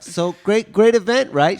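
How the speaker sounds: high, loud hammed-up Trump voice; mock Trump interviewer. S1 voicing Trump grilling Melania, high and loud. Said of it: "She's like".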